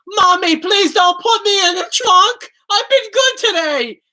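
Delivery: high, loud, slow pleading scream; fake child's scream. In the parking lot, screaming at his mother like a scared child. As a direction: wail like a kid begging his mother. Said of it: "I started screaming".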